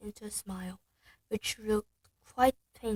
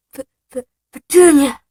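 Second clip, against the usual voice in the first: stammering, then loud and higher; gasping in shock; 4 semitones higher, 19 dB louder than usual. Acted. Shocked uncle gasping for his wife. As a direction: choke out her name in horror. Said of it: "he gasped".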